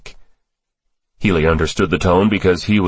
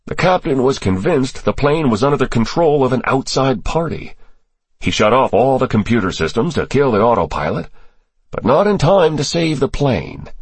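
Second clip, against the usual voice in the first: higher-pitched character voice for testimony; briefing official; 7 semitones higher than usual. Acted. Official briefing senators on terrorist ties, higher character voice. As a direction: lay out the intelligence in a raised register.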